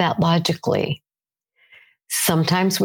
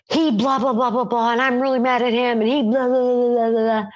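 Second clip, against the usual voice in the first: higher, hammed-up indignant venting; mock angry rant; 7 semitones higher than usual. Venting to her good friends about her husband, mock indignant. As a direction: rattle off the blah-blahs like a worked-up complaint.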